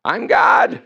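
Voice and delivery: high, loud exasperated shout; hammed-up divine exasperation; exasperated God. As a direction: shout it like an eye roll out loud. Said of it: "doing eye rolls on a regular basis".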